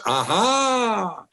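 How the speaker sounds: high, bright mock triumph; a pleased old man who finally got his point across; triumphant old man. Old man's triumphant punchline.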